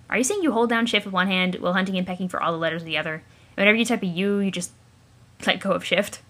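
ordinary talk, nothing marked

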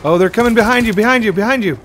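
high, loud warning yell; urgent shout. Real, not acted. Teammates under fire, warning.

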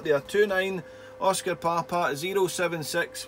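ordinary talk

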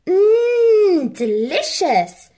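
high, loud drawn-out mmm of delight; savouring Harry. A narrator's reading. Boy tasting the secret cake, delighted. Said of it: "said Harry as he licked".

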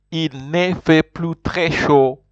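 "Il ne fait plus très chaud" said slowly, each word clear; slow model phrase. Shown as a demonstration. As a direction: lay it out slowly for the class to repeat. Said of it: "Repeat after me".

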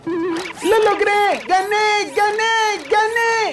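high, thrilled cheering; excited cheering. Acted. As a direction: celebrate a win. Robot winning.

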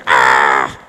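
loud low exasperated cry; a sudden mock outburst at an eye-roller; frustrated yell. Speaker acting out lashing out at a rude listener, loud frustrated shout. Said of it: "you wanna just, like".

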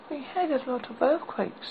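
put-on little girl voice. A narrator's reading. Dorothy speaks.

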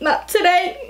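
high sassy not today with rising end; playful diva refusal, rising. Acted. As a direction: toss it off high, lifting today.

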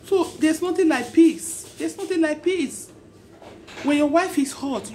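impassioned preaching. Acted. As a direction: preach it hard, pleading with them to stop. Preaching peace to couples.

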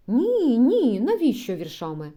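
гучно, вище, рішуче «Ні»; відмахується від віршів; різка відмова. Acted. (гучне обурене «ні»)

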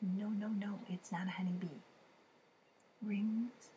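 plain reading voice

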